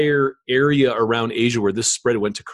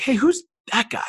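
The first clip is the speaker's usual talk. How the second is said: higher, eager question; curious young self; 13 semitones higher than usual. Retold or imitated. Young student at a tournament asking his teacher, curious. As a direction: perk up and ask who that is. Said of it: "be like".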